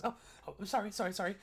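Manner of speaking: high, flustered burst of sorries; startled apology. Acted. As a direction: jump out of your skin, then apologise fast. Startled shopkeeper drops his coins.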